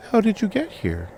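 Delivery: put-on high childlike question; young girl voice. A narrator's reading. Girl peppers the stranger with questions, high childlike voice. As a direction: ask it like a curious little girl.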